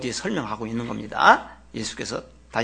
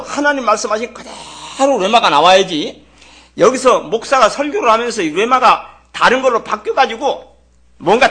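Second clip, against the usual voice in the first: loud, high-pitched impassioned preaching; preacher's raised, emphatic warning; forceful preaching; 8 semitones higher, 7 dB louder than usual. Real, not acted. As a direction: drive the warning home at raised pitch and volume. Preaches forcefully about altered message.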